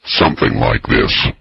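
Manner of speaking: deep, growling synthesized Bigfoot voice; bigfoot grunting. Pretend. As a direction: rumble it low like a beast through a synthesizer. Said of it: "Bigfoot's voice would sound like through the synthesizer".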